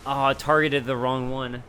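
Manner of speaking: ordinary delivery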